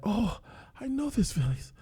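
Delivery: put-on high, soft, wandering old man's voice; a dreamy, lost old man remembering; confused old man. The old man recognising his old village, high and dreamy.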